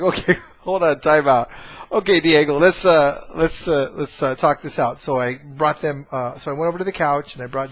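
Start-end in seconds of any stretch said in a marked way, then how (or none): 0.0-5.0 louder, firm call to stop the fight; a dad cutting in loudly to halt it; calling time out. Retold or imitated. Father breaking up his sons' fight, loud firm call.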